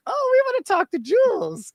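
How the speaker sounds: very high gushing mock-fan voice; imitated eager fans. Everyone swooning when Julian shows up, imitated eager fans. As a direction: squeal it like a smitten crowd. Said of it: "Everyone's just like".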